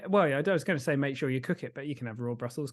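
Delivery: ordinary talk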